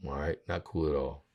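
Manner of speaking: normal talking voice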